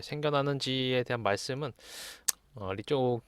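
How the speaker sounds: ordinary talk, nothing marked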